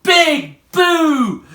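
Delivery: loud, high big boo; Big Mug shouts at the children; shouted boo. Acted. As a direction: boom it out to scare the kids. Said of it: "shouted Big Mug".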